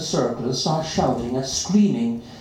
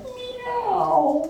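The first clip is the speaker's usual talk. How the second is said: much higher, very slow plaintive line; the hungry cat begs his owner, plaintive; sad meow voice. A narrator's reading. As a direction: beg for food.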